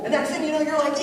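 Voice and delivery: normal talking voice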